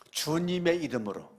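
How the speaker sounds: ordinary talk, nothing marked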